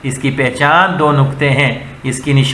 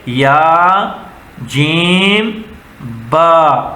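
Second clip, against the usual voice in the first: یا, جیم, با each held apart, جیم lifted; teacher modelling letter names slowly; slow letter-by-letter reading; much slower than usual. Shown as a demonstration. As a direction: sound out the letters one by one. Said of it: "کیسے پڑھیں گے؟".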